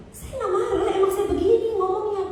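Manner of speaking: high-pitched mock yelling; imitated shouty talk. Husband insisting he is not angry, mock shouty. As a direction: mimic someone whose normal talk sounds like yelling. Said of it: "Dia ngomong aja kayak teriak-teriak".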